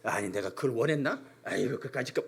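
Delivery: low, soft grumble under the breath; grumbling. Retold or imitated. Husband grumbling to his wife instead of apologizing, low grumble. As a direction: mutter it like a sulky husband. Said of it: "툴툴거려".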